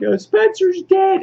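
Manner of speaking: high falsetto lament; hammed-up, very high-pitched cry; mock dismay. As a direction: squeal the bad news in put-on distress. News of a death, acted.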